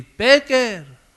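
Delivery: high, loud call of the name. Acted. Jesus calling a listener, high loud call. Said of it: "como si ahora te llama a ti".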